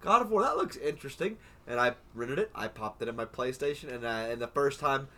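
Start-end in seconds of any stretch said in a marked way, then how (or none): 0.0-1.4 curious. Retold or imitated.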